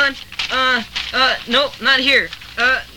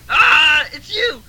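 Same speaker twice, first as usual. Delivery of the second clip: startled shout; 4 semitones higher, 4 dB louder, much slower than usual. Acted.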